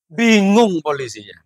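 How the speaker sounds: normal talking voice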